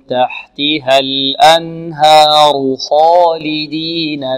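slow, drawn-out chanted recitation; Quran recitation. Shown as a demonstration. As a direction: recite it slowly with tajweed. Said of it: "teacher models recitation". (ترتيل بطيء)

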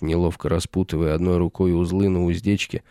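ordinary delivery

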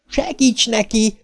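high, drawn-out cry for help; yelled plea. A narrator's reading. Beverly orders Ben to help Bill, yelling. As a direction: stretch it out in a high-pitched yell. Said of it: "ordította".

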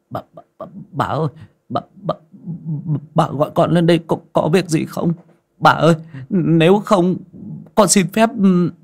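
high, fast flustered stammer. A narrator's reading. Flustered boy stammers before his half-dressed mistress. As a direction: trip over every word, embarrassed. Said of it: "mà lắp bắp".

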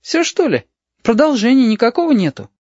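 put-on high, disappointed child's whine; young boy miffed that it ended; vexed. A narrator's reading. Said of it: "спросил с досадой".

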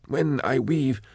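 high, thin, trembling-soft voice; lost and slipping away; frail frightened man. Acted. Sark fading, frightened.

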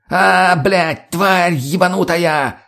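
high, drawn-out howl; roar of pain. A narrator's reading. Mutny is shot in the leg, pained roar. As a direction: howl the curse in pain and anger. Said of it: "взревел Мутный".

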